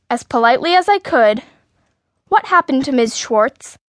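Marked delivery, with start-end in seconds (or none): none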